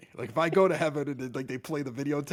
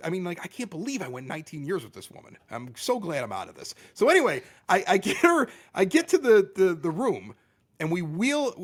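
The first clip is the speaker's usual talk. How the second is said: ordinary delivery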